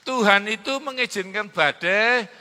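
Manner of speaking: preacher building to a roaring climax; full-force preaching. Acted. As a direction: stretch every word to rouse the church.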